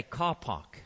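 CAR PARK in a regional accent, vowels rounded; accent demonstration. Shown as a demonstration. Preacher shows how speech gives a man away, accented "car park". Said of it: "But it's an accent".

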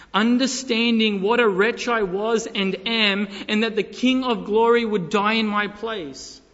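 loud, forceful pulpit reading; impassioned preaching. Retold or imitated. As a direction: read it out with full conviction. Reads text message as preaching.

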